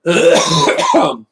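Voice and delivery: loud, high hacking cough; harsh cough. Real, not acted. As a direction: cough hard after straining. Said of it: "coughing up blood myself now".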